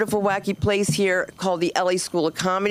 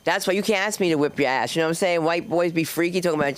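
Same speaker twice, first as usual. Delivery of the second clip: ordinary delivery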